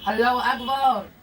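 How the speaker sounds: loud, slow joyful exclaim; delighted exclamation. Real, not acted. Admiring the finished croissant, loud and delighted. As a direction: marvel out loud.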